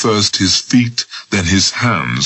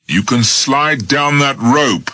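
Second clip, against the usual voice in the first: slow, hard command; brutal; 8 semitones higher, slower than usual. Acted. Smith brutally orders Jones down the rope. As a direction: lay it down brutally. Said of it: "Smith said brutally".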